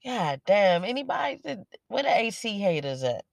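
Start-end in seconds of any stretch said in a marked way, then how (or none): 0.0-0.8 loud, fed-up God damn; heated complaint about the AC; exasperated outburst. Real, not acted. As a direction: let it burst out in frustration. Venting loudly about the AC.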